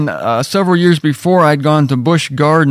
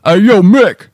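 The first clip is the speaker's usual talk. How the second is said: louder, rough Rocky voice; Rocky impression; 6 semitones higher, 5 dB louder, slower than usual. Shown as a demonstration. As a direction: punch it out like a Philly boxer. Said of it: "only thing that remotely sounds like Rocky is".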